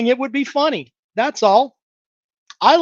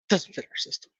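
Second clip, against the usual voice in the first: whispered mockery; 11 dB quieter, faster than usual. Muttered mockery of the excuse.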